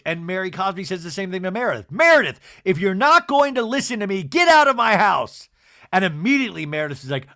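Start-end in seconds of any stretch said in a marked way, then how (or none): 1.9-5.5 loud, high mock scolding; imitated furious order; angry ultimatum. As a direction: throw someone out in a raised voice. Mary ordering Meredith out, retold.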